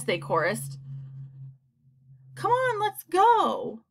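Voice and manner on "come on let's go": high, drawn-out call; eager urging. A narrator's reading. Creatures ready to climb upstairs, eager high call. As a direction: urge them on high and slowly stretched.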